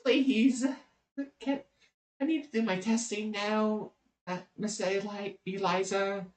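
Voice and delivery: meek, high-pitched polite plea; pleading little creature. Acted. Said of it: "It's like".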